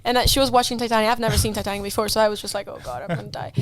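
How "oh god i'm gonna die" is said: low, soft muttered dread; quiet despair. Retold or imitated. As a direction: mutter it low to yourself, done for. Despairing thought during late film.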